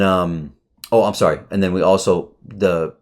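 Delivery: usual speaking voice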